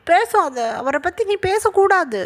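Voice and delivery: high, drawn-out, swooping objection; indignant protest. Acted. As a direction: forbid it in a slow, high-pitched protest. Grandmother forbids talk against her favourite. (உயர்ந்த குரலில் இழுத்துச் சொல்லும் கண்டிப்பு)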